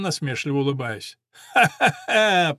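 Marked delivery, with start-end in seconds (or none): 1.5-2.6 loud, slow, hearty guffaw; rolling laughter. A narrator's reading. Owner mocking the Englishman's offer, rolling laughter. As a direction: laugh the rival off. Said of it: "покатывался Васильковский".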